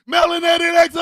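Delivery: loud showy sponsor boast; proud declaration. Acted. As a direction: announce it grandly.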